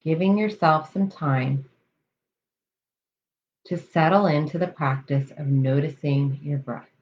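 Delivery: calm, measured meditation voice with long pauses; guided meditation. Real, not acted. Coach leading listeners through breath awareness, guided meditation. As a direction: settle them into the breath, unhurried and even.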